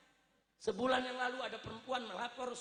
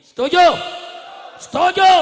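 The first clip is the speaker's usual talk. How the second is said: huge one-word crowd call; full-volume rally cry; rallying shout; 5 semitones higher, 19 dB louder than usual. Real, not acted. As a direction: bellow it for agreement, louder and higher the second time. Calling crowd for agreement.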